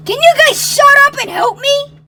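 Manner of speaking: loud, high, exasperated yell; angry shout. Acted. Trapped Mario cutting off bickering friends, angry shout.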